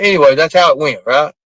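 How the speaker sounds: usual speaking voice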